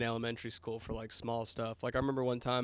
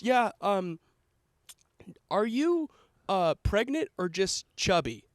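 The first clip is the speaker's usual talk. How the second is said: put-on higher, hesitant kid's question; innocently drawn-out, higher-pitched asking; mock kid questioner; 6 semitones higher, slower than usual. Kid asking the art teacher a rude question, mock innocent. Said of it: "and he goes".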